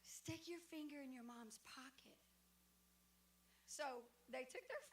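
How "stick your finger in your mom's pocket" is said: hushed, conspiratorial aside; retold whisper. Friend plotting with child by the ticket holder, whispered. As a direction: drop to a soft stage whisper. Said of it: "So she whispered".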